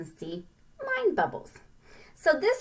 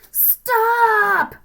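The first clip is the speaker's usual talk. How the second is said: high, loud sharp cry; shout; 13 semitones higher, 10 dB louder than usual. Acted. Littlest ant shouting at the marching ants. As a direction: yell to halt the line.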